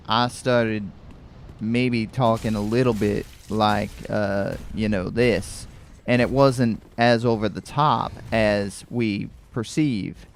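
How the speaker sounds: light Southern drawl demonstration; Louisiana drawl. Shown as a demonstration. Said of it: "developing a little bit of a drawl".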